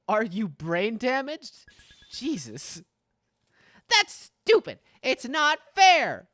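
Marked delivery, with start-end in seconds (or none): 3.9-6.3 higher and louder, furious protest; stretched, indignant yell on fair; angry outburst. Acted. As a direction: shout it at your parents. Min's quarrel with her parents.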